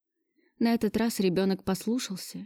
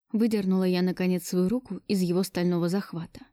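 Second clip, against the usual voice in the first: usual speaking voice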